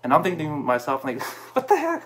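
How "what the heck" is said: sharp high jump of disbelief; incredulous outburst; disbelieving what the heck. Retold or imitated. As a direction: squeak it out, stunned and half laughing. Inner reaction to mentor's refusal.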